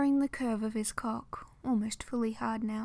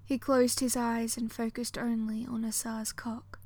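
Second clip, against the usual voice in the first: ordinary delivery, nothing marked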